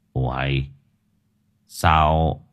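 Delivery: put-on an old man thinking to himself; puzzled old man. A narrator's reading. Old man wonders aloud.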